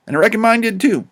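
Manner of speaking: plain reading voice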